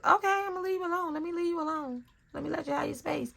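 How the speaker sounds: high, quick, hushed backing off; fast, high-pitched appeasing; acted placating retreat. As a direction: back away with a high little voice. Acting out her placating retreat.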